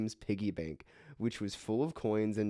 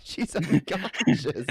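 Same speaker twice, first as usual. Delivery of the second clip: slow, loud, incredulous; exasperated; 6 semitones higher, 8 dB louder, slower than usual. Real, not acted. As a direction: say it like it's obvious. Exasperated correction mid-laugh.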